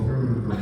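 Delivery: put-on low soft rolled R purr for the girls; rolled R growl.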